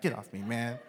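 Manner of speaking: low, soft annoyed aside; quiet, sulky grumble; grumbled rebuff. Acted. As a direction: shrug the friend off under your breath. Muttered rebuff of condescending friend.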